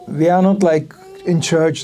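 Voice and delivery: ordinary talk, nothing marked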